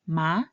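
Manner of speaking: MA rising from mid-range; MA lifting up, like asking a question; second tone. Shown as a demonstration. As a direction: say MA with the pitch sliding upwards. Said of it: "when read in the second tone".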